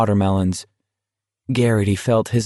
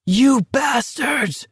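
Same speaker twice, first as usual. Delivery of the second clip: high, slow, stretched-out scream; 14 semitones higher, much slower than usual. Acted. Walker screaming at troopers dragging Dom away, high drawn-out scream. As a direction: hurl it at them with everything. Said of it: "Garrity screamed with all his force".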